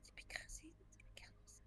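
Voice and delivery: very soft, unvoiced whisper; secretive, barely audible whisper. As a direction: whisper it so nobody overhears. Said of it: "why are you whispering".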